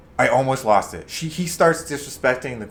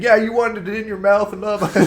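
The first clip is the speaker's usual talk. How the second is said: high-pitched teasing imitation; mocking taunt; 8 semitones higher than usual. Friends making fun of the guy who clowned him, mocking. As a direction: jeer in a raised voice, breaking into laughter. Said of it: "they made fun of him for saying".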